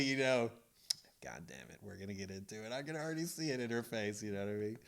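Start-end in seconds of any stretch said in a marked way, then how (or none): 1.2-2.7 muttered dread. Retold or imitated. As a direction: grumble it under your breath. Inner thought seeing wife's face.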